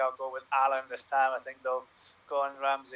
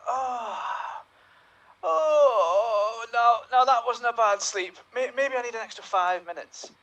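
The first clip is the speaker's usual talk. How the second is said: sleepy Aurora waking; 6 semitones higher, slower than usual. Acted. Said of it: "you are Aurora".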